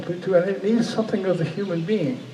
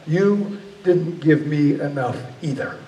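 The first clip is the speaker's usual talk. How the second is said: slow, measured comeback at ordinary pitch; God's stern retort. Retold or imitated. God grabs the dreamer by the throat, retorting slowly and sternly. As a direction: answer back deliberately, without raising the voice. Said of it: "He grabbed me by the throat".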